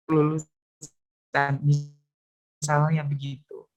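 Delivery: breaking up on a bad line; choppy audio. Real, not acted. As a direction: voice cutting in and out. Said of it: "Suaranya putus-putus". (suara putus-putus)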